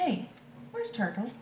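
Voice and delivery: ordinary delivery, nothing marked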